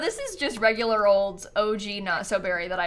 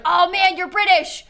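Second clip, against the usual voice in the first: loud, high, eager fan voice; high, loud mock-excited waiter; 9 semitones higher, 6 dB louder than usual. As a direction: mimic an eager stranger gushing. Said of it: "They're like".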